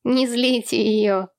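slow, drawn-out impish warning; mischievous. A narrator's reading. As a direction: say it like a naughty boy. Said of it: "озорно произнёс Сол".

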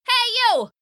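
high-pitched bird calling into a hole. Acted. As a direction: call out for someone's attention. Pheasant calls into mouse hole.

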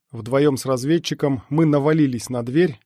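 usual speaking voice

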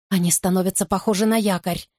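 ordinary delivery, nothing marked

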